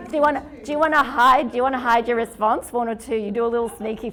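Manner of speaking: high, loud playful teasing; bouncy teasing call. Acted. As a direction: tease the shy class loudly. Playful teasing of nervous class.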